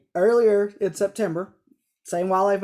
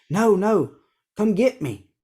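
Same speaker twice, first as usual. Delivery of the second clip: higher, louder mock-urgent phone plea; 4 semitones higher, 4 dB louder, slower than usual. Cousin phoning from the woods for rescue, mock-urgent. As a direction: imitate a cousin begging for pickup.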